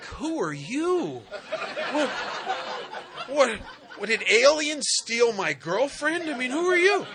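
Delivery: slow, rising, laughing disbelief; baffled disbelief. Retold or imitated. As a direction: ask it like your girlfriend was swapped. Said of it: "he's looking at her like".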